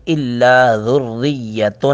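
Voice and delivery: slow drawn-out recitation; recited phrase. Shown as a demonstration. Tajweed teacher demonstrating the verse, slow chant. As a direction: chant the words slowly. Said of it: "ذال کو نرمی کے ساتھ ادا کریں گے".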